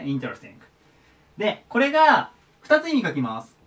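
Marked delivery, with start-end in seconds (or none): 0.0-0.5 interesting with the weight on "in"; stress on the first syllable. Shown as a demonstration. Teacher showing students the accent on interesting's first syllable. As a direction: hit the opening "in" of interesting. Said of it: "最初にアクセントがきますね".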